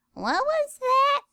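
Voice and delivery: high startled what was that; startled question. Acted. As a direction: react as if you heard something odd.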